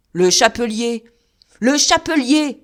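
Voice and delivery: man howling at a vision. Acted. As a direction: howl the name in terror. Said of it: "hurlait Coupeau".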